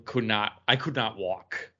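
ordinary talk

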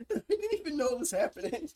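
high, quick, giggly defence; laughing protest. Real, not acted. Host defending the accidental mouse touch, laughing protest. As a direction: protest helplessly through real laughter.

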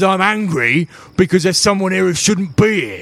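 high-pitched irate dwarf guard; angry. Acted. Dwarf guard answering why he is angry, high irate voice. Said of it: "Why are you so angry".

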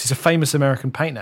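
usual speaking voice

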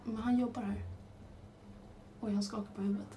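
hushed whisper. Real, not acted. Whispering about a man nearby.

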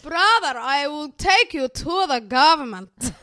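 a Somali mum threatening her brother; Somali mum accent. Retold or imitated. Friend imitating a TikToker's Somali mum accent. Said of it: "I just love her accent".

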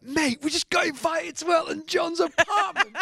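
very high-pitched excited squeal, retold; acted giddy disbelief. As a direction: squeak it out like amazed mates. Acts out band's amazed reaction.

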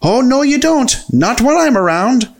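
high-pitched indignant refusal; acted rage. A narrator's reading. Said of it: "in a purple rage".

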